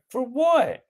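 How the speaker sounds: put-on high-pitched, incredulous kid retort; mock indignant protest. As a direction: squeak out an acted protest. Scolded son protests in skit.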